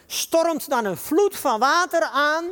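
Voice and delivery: high, loud, slow proclamation; forceful declamation. Acted. Preacher reciting David's psalm to the congregation, forcefully declaimed. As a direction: let each word swell like an oncoming flood.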